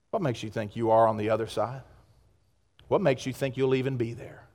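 low, soft, sobering question; hushed challenge. Real, not acted. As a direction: drop right down and confront them quietly.

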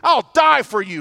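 loud, high and boastful vow; brash bold vow. Retold or imitated. Brash fisherman Peter loudly vowing to his Lord. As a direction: swear it out loud like a cocky fisherman. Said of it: "This brash, bold fisherman who said".

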